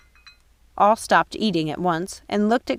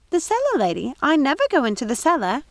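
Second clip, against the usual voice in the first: high, puzzled housekeeper voice; wavering, lost old woman; confused old woman; 8 semitones higher than usual. Acted. Mrs Tittlebottom asks Gothel about her fall, confused.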